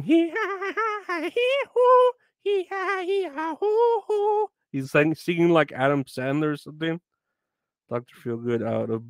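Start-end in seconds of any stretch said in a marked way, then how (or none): 0.0-4.5 very high gasping hee-ha pants; mock out-of-breath panting. Imitating a winded singer's panting. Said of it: "like his lip syncing".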